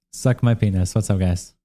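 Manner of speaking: ordinary talk, nothing marked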